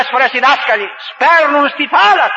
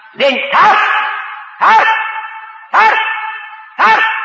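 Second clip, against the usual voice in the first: climbing preacher's call; rising preacher's cry; 8 semitones higher, faster than usual. Real, not acted. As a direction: build it up, crying out each time.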